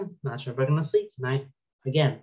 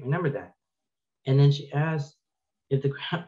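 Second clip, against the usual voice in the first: usual speaking voice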